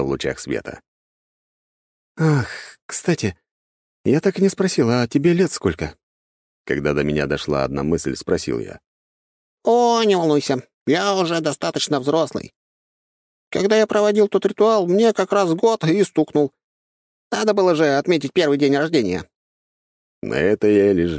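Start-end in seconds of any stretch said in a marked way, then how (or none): 2.2-6.0 high, light talking squirrel; chirpy little creature voice. Acted. Squirrel asks. 9.6-19.3 high, light talking squirrel; chirpy little creature voice. Acted. Squirrel explains.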